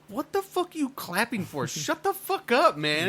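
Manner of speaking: high-pitched exasperated outburst; mock outraged rant. Moviegoer to a clapping premiere crowd, mock outrage.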